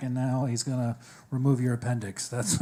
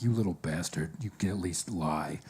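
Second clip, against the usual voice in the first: put-on low-pitched grumble at a kid; hammed-up low aside; mock grumbling reproach; 8 semitones lower, 5 dB quieter than usual. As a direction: mildly scold a child under your breath. Acted aside to translating child.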